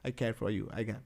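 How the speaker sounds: low, very soft, offhand murmur; apathetic. Acted. Lecturer acts out what apathy means, low apathetic murmur. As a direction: drop it low and quiet, barely bothered. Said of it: "Apathy. Apathy means".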